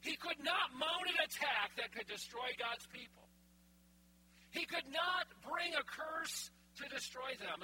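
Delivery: loud, emphatic preacher proclamation; forceful sermon declaration; preaching at full force. Acted. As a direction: hammer the point home. Preaching the point.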